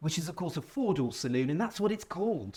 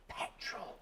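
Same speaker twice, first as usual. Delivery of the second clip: whispered; 14 dB quieter than usual. Real, not acted. Teasing reveal of petrol drive.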